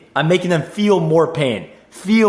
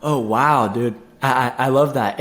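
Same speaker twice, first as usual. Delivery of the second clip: lower, softer, tender admiration; soft heartfelt wow; 4 semitones lower than usual. Shown as a demonstration. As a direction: meet their kindness gently and sincerely. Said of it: "see how my tone is matching the feeling".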